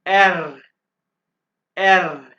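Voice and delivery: एर said loud with a trilled r; loud German R with the tongue vibrating; rolled R. Shown as a demonstration. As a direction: roll the tongue on एर. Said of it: "जुबान को vibrate करना है".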